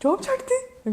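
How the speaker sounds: very high-pitched mock disbelief; imitated shocked mother. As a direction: squeak it like a stunned mum. Mother reacts to news of resignation.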